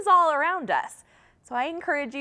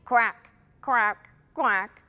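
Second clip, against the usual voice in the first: short duck quacks, kept low; 3 semitones lower, slower than usual. Shown as a demonstration. Teacher shows children what sound a duck makes, duck quack. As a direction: imitate a duck's quack. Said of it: "what sound a duck makes".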